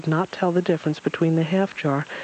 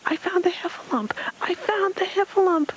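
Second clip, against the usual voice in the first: extremely high excited squeal; shrieky little creature, thin and very high; Piglet. A narrator's reading. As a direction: squeal the news at the very top of your voice range. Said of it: "Piglet squealed even louder".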